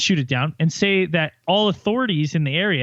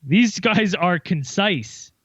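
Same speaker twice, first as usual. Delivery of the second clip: slow review read; much slower than usual. Acted. Reading a review aloud slowly.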